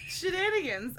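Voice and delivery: put-on "Shenanigans" laughed out high, copying the otter's British accent; echoing the British "Shenanigans". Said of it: "how you said shenanigans".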